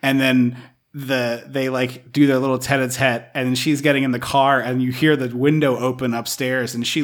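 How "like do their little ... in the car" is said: ordinary delivery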